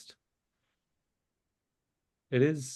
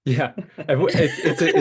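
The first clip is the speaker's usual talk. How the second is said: laughing; 3 semitones higher, 6 dB louder than usual. Real, not acted. Laughing at idea.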